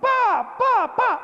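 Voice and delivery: high, punchy smashing sounds; sharp high-pitched "pa" hits; imitated kicking noise. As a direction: imitate boot blows on glass. Imitates boots breaking the glass.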